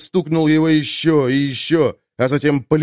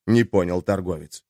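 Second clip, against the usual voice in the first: ordinary delivery, nothing marked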